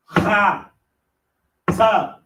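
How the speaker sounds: ordinary delivery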